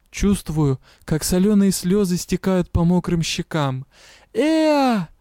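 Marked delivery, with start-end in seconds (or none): 4.3-5.1 high pleading cry; desperate call. A narrator's reading. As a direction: cry out in panic. Calling for help in the sea.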